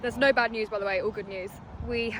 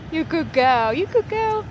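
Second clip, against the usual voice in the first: praising dog; 6 semitones higher, 5 dB louder than usual. Real, not acted. Praising puppy.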